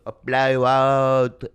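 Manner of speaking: loud, drawn-out Korean accent imitation. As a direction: make a big slow offer in a mock Korean accent. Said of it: "he goes".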